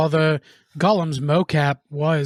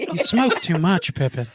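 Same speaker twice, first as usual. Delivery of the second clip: Gandalf impression; 2 semitones higher than usual. Quoting Gandalf.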